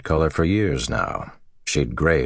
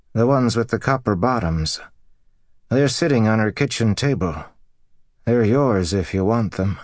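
lightly voiced farmer, raised pitch; dazed husband relaying news; 6 semitones higher than usual. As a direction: pass on a puzzling message to your wife. Voices husband relaying neighbour's message.

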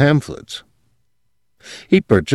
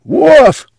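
very high loud bark; dog barks a loud high summons; summoning bark; 7 dB louder than usual. Acted. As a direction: bark out a call. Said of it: "he summoned".

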